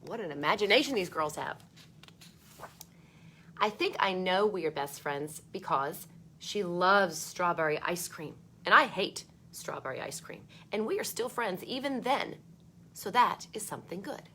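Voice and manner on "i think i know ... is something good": put-on bright read-aloud voice for children; storybook reading. A narrator's reading. Teacher reading a book to kids, lively read-aloud.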